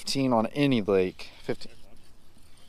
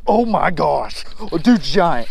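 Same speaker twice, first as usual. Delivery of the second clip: loud, higher burst over a giant fish; excited; 4 semitones higher, 5 dB louder, slower than usual. Real, not acted. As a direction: shout it out, thrilled. Big fish on the line.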